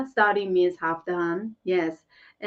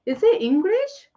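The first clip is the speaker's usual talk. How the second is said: delighted; 5 semitones higher, 4 dB louder than usual. Real, not acted. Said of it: "That's such a cute inky ponky".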